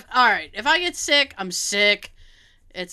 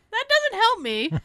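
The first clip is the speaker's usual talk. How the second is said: higher, exasperated mock whine; whiny complaint; 12 semitones higher than usual. Patient complaining to the doctor, mock whiny. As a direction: whinge that his answer is useless. Retold reply to unhelpful doctor.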